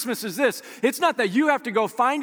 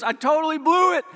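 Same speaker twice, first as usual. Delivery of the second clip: higher, animated imitation of a friend; retold excitement; 6 semitones higher, 3 dB louder than usual. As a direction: confess the slip excitedly, as your buddy would. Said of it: "he was so excited".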